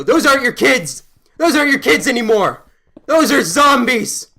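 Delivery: loud, high-pitched alarmed yell; urgent shouting. Retold or imitated. Storyteller warning a homeless father about zombies, shouting. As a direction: yell it like a zombie-movie survivor.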